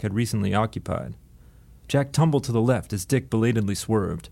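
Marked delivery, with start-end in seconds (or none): none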